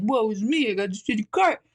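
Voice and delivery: lightly hammed-up woe-is-me whine; mock self-pity. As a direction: poke fun at your own sulking. Mocking her own self-pity.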